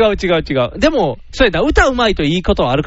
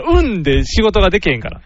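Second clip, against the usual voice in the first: ordinary delivery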